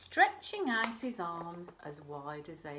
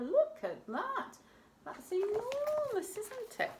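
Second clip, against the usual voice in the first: much higher, marvelling; wide-eyed wonder; 5 dB louder than usual. Real, not acted. Reader shows children the picture, marvelling.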